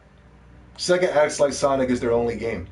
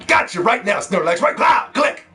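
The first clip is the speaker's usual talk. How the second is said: high, loud gleeful game shout; excited shout; 7 semitones higher, 5 dB louder, slower than usual. Pretend. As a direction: yell it as you snap the shot. Acting out snapping a photo.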